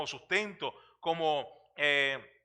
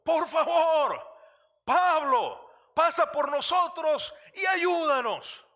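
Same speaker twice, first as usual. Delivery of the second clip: high, loud, slow pleading shout; desperate cry for help; 12 semitones higher, 5 dB louder, slower than usual. Acted. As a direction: beg at the top of your voice, near tears. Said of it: "un hombre allí gritando, llorando tal vez". (grito desesperado y suplicante, agudo y fuerte)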